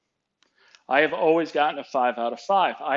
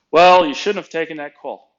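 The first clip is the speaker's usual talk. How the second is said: louder, lightly acted reprimand; retold scolding; 3 semitones higher, 12 dB louder than usual. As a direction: hit the opening word hard, like a reproving boss. Boss scolds in retold quarrel.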